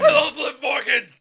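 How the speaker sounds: high, full-blast slow shout; Eric Young. Acted. Joining in the impression of a wrestler, high slow yell. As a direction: bellow it in a strained wrestler voice. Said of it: "I can't do an Eric Young".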